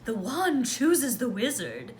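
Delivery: a hint of a grand wizard shopkeeper; mystical wand seller. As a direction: ham it up like Ollivander. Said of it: "almost like we're in Diagon Alley".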